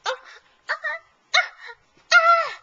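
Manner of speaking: short cries building to a high, loud yell; pretend cries. Toy is told to go away in play, mock yelling.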